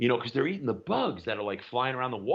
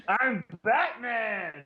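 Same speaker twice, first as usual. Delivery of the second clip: slow, high, hammed-up line; mock Batman; 10 semitones higher, much slower than usual. Joking about bat powers, slow hammed-up Batman. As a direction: ham up a hero line.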